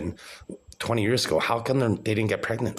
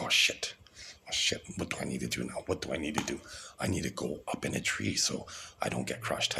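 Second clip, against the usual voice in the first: hushed, breathless voiced-down panic; panicked whisper; 3 semitones lower, 7 dB quieter than usual. Super egg panicking in his story, hushed frantic whisper. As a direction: whisper like a startled little creature.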